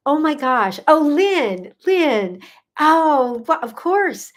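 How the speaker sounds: real, heartfelt exclamation of delight; touched delight. Real, not acted. Host moved by Lynn's praise of her consult, touched delight. Thrilled reaction to viewer's praise.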